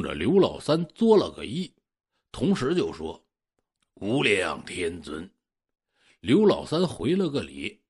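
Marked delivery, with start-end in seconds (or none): 3.9-5.3 put-on old Taoist priest. Old Taoist greets him.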